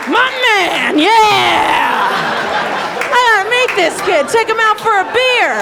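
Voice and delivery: high, loud, excited guy voice; hyped-up bro. Retold or imitated. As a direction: yell it like a pumped buddy at a bar. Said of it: "He's like".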